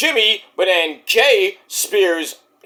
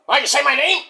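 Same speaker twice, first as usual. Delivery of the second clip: Mike voice; 6 semitones higher, much faster than usual. Acted. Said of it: "We'll get Mikey after you".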